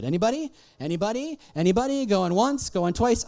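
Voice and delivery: loud, high auctioneer-style call. Pretend. Speaker polling the audience like an auctioneer, calling out. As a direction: call round the room for any takers.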